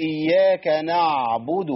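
"نعبد" with the ayn held too long; slow "إياك نعبد", dragging the ayn; over-lingering on ayn. Retold or imitated. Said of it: "كتير أوي كده".

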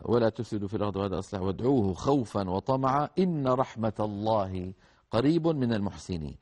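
Quran recitation. Acted. Recites the verse.

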